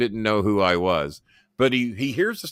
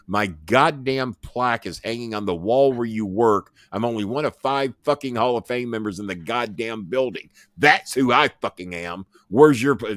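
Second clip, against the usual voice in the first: usual speaking voice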